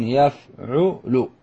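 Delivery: يفعل as slow yaf-'u-lu; syllable by syllable. Shown as a demonstration. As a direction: sound out يفعل, each syllable separate. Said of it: "على وزن".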